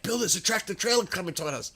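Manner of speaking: high, fast, urgent friend's warning; panicked passenger yelling a warning; alarmed warning. Retold or imitated. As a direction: blurt it out as a startled passenger. Friend warns of oncoming truck.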